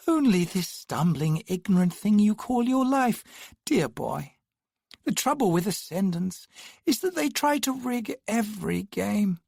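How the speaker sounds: put-on patronize the dear boy; condescending twin. A narrator's reading. Condescending twin voiced high.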